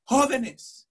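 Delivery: "Jóvenes" with a Spanish h sound, stressed on the first syllable; Spanish pronunciation. Retold or imitated. Student reading the word on the page, correct Spanish "Jóvenes". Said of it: "it's jóvenes, not Jovenes".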